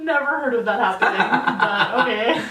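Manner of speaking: amused, giggling protest; laughing disbelief. Real, not acted. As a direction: talk through laughter. Laughing while retelling her reaction.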